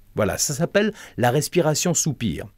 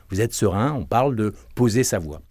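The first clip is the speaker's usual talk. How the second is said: normal talking voice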